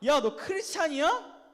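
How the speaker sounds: high, loud, drawn-out taunting question; mocking tone. Shown as a demonstration. Preacher demonstrating mockery of Christians, jeering. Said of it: "이런 말투로 말하세요".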